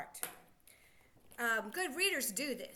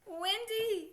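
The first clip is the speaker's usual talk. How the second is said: Windy said higher and louder, falling; excited reveal; 7 semitones higher, 4 dB louder than usual. Shown as a demonstration. Teacher revealing the word to the children, higher and louder. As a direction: cheer the answer out. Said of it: "So what is the word?".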